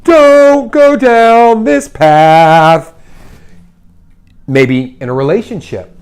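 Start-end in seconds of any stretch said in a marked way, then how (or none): 0.0-2.9 loud, high, drawn-out warning; imitating life calling out a slow warning. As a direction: stretch each word out in a raised, booming call. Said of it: "And life is like".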